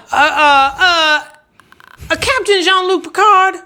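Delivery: high, strained goofy voice; high silly voice. Pretend. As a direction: squeal the name out. Silly voiced patron names.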